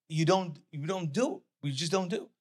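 ordinary talk, nothing marked

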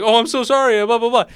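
high, loud mock-flustered apology; hammed-up flustered rich mom backpedaling. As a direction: gush a panicked fake sorry. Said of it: "she's like".

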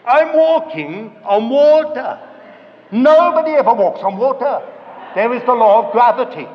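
loud, acted incredulity; alarmed realisation. Peter realising he is on the water, loud alarm. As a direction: proclaim the sudden doubt at full volume. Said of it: "he suddenly thought to himself".

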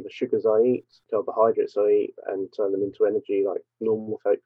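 ordinary talk, nothing marked

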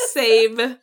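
loud burst of "Save"; excited "save". Retold or imitated. Friend retelling finding the song, excited shout. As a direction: blurt it out like a found treasure.

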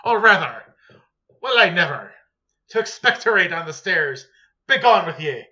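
loud, hammed-up posh indignation slipping into Scottish; fancy voice turning Scottish. Host scolding spitters at the opera house, fancy voice sliding into Scottish. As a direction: put on fancy airs that devolve into a Scottish accent. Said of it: "my fancy voice always devolves into Scottish".

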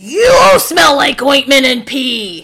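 slow, high, loud taunt; mock shout. Co-host topping the other's cartoon insult, drawn-out mock shout. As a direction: holler it, stretching the words. Hosts trade louder takes.

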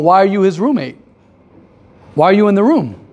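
ordinary talk, nothing marked

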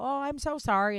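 high, loud, over-the-top sorry; mock apology. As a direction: ham up a frantic apology. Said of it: "I go".

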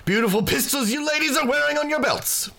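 high, loud boisterous haggler; boisterous trader. Acted. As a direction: play a big loud British trader buttering up customers. Game master voices Quint the trader.

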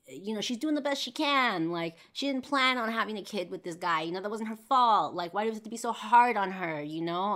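high, loud protest in a friend's defence; heated, insistent defensiveness; defensive. Retold or imitated. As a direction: push back hard, voice climbing. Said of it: "I would get super defensive".

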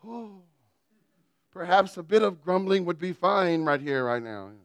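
high "Oh", then a prim, put-on voice; lightly playing a fussy gentleman; mock dismay. Parent opening a dirty diaper, a lightly posh mock complaint. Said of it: "and you go".

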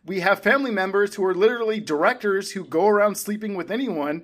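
high, loud, worked-up argument; heated rant. Real, not acted. Film critic challenging co-host over the family blight, heated rant. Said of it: "[name] was ranting".

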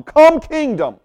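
high, loud stress on "Come"; authoritative call. Shown as a demonstration. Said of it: "with authority, we're calling for the kingdom".

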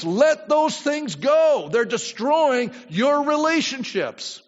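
fervent preacher pressing the congregation; preaching at full force. Acted. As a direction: build into a pulpit exhortation. Preacher rising to exhort.

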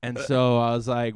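ordinary delivery

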